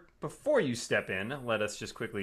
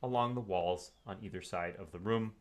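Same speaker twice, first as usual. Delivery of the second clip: game master scene setting; 4 semitones lower, 5 dB quieter than usual. A narrator's reading. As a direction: set the eerie scene quietly. GM describes the room.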